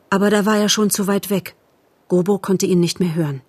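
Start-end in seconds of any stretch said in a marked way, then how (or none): none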